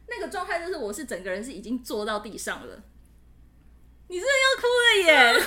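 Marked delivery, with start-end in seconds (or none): 4.1-5.5 high, loud laughing tease; real laughter, much higher and louder; laughing at friend crying. Real, not acted. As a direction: laugh it out, teasing her tears.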